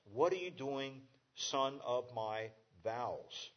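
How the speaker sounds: slow, weighty reading of vows. Shown as a demonstration. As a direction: stretch son of my vows slowly. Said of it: "that third phrase carries the additional weight".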